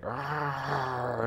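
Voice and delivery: high, drawn-out "arr" roar; monster bunny roar. Acted. Baby bunny destroying a city, high mock roar. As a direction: roar like a little rampaging monster. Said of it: "the baby bunny is like".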